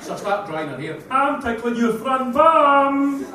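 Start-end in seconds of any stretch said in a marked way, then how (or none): 1.1-3.2 put-on higher, louder, slow toddler announcement; loud child voice. Daughter announcing to the changing room, loud high slow. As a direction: announce it loudly like a proud kid. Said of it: "daughter's reply in changing room".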